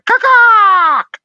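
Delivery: loud, high squawk; piercing mock bird call. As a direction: squawk it out at full volume.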